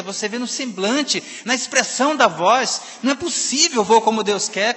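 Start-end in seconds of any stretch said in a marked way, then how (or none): 3.8-4.7 louder mock of a sad, resigned reply; imitated sadness. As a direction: parody the gloomy answer, pushing it out. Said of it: "com essa tristeza".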